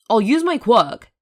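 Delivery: loud, slow threat; threatening. A narrator's reading. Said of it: "Hisashi threatened".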